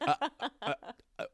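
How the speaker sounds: high stammering, shocked; high stammering mock shock. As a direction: imitate stunned friends. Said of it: "in shock".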